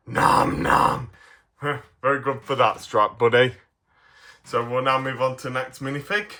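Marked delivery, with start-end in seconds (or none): 0.1-1.1 unvoiced-sounding chomping noises without pitch; mock munching. Toy Stripe pretends to eat Grumpy, munching. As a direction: pretend to gobble someone up.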